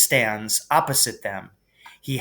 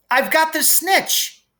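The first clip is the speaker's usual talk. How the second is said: high, loud triumphant yell; triumphant shout; 14 semitones higher, 3 dB louder than usual. A narrator's reading. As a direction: shout it out in victory. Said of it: "he shouted".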